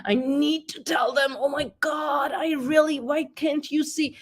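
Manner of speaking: high, exasperated burst of ego; frustrated outburst. Retold or imitated. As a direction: blurt it out in irritated impatience. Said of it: "If I am feeling the urge, like".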